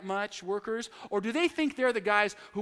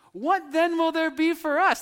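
higher, louder eager plea; eager question; 8 semitones higher, 6 dB louder than usual. Acted. Peter pressing Jesus for reward, eager. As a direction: push it up like a kid wanting a prize.

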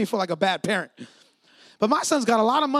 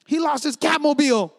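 raised, mock-indignant complaint; retold upset outburst; 4 semitones higher, 6 dB louder than usual. Dad to wife about the lost toy, retold upset outburst. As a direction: build to a loud, higher-pitched gripe. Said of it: "And I was so mad".

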